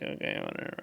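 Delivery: flat, whispered, utterly unbothered; bored whisper. Retold or imitated. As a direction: shrug it off under your breath. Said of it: "And it's like".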